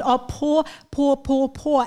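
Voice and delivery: "Op, po, po, po, po" chanted steadily; rhythmic R drill; syllable drill. Shown as a demonstration. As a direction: repeat "po" with the tongue pulled back for R. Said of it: "tongue will pull back up for that R sound".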